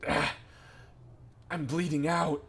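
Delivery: groan, then announce it like a hammed-up casualty; wounded cry. Gray, holding his bleeding throat, calls out in pain. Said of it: "he says out loud".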